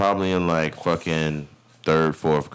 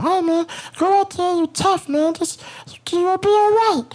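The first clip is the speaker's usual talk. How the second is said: very high coaxing inner voice; high calming voice. Retold or imitated. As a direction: soothe yourself in a squeaky high voice. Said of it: "this is my voice that I use to calm myself down".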